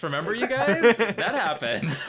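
high, loud mock line to the audience; hammed-up wink at the viewers; bad acting. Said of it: "She's telling the audience".

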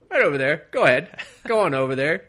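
high and loud, gleeful pointing the way; eager egging on. Retold or imitated. Inmates point the new guy at Willis, loud gleeful urging. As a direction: cheer him on toward the fight.

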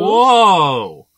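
loud, high cry of surprise; shocked outburst. Real, not acted. Friend stunned by a surprise confession, shocked outburst. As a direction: react big and loud to a bombshell.

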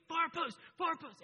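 high, loud sideline yell; yelling far post. Retold or imitated. Dad to his son all season, a loud yell. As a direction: bark it from the sideline. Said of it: "I've been yelling at this kid".

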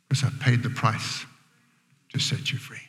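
low-volume, fading into a whisper; hushed solemn promise. Acted. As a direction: let it sink to a soft whisper. Speaks as Christ, hushed climax.

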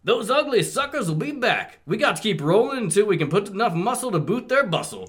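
high, cocky cartoon robot; Blaster voice. Podcaster reading Blaster's line to his cohosts, in a high cartoon voice. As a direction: pitch it way up like a wisecracking eighties cartoon bot.

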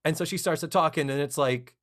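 ordinary talk, nothing marked

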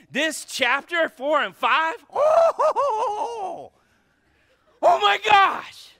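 excited shout. Real, not acted. Preacher erupts in excitement.

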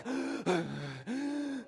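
big acted gasp of relief; long, dramatic intake of breath; relieved gasp. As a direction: gasp as if a missing loved one walked in. Acting out relief at wife's return.

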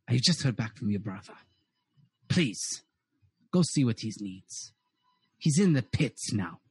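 low-pitched voice heard through a door; muffled overheard voice. Acted. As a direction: play someone talking low behind a door. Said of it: "two voices muffling".

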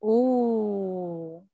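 long drawn-out "ooh" of appreciation; admiring ooh. Real, not acted. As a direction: let out a lingering, impressed ooh. Reacting to the hot book cover.

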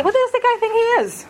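high-pitched, indignant crowd grumble; mock grumbling. Crowd grumbles about Jesus' claim, mock-indignant grumbling. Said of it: "they begin to grumble and say".

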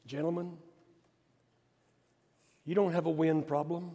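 soft, unhurried and steady, pausing between lines; calm him down like a patient teacher; calm Jesus. Retold or imitated. Jesus calmly answering frightened apostles, soft and steady. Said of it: "Jesus will get up in his calm spirit".

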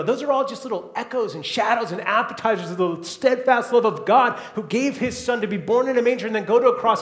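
usual speaking voice

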